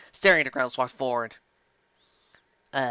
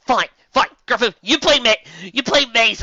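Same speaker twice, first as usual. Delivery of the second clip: exasperated assigning; 8 semitones higher, 6 dB louder than usual. Acted.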